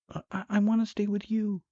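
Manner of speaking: high, quick, stammering woman's voice; fast high-pitched female plea; pleading wife. Acted. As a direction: plead in a put-on high voice, tripping over the first word. Narrator voices the frightened wife.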